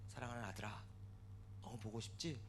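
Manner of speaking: soft, low, fatherly question; gently console, almost under your voice; tender divine voice. Retold or imitated. God speaks to his grieving son, tenderly. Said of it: "하나님이 저에게 이런 말씀".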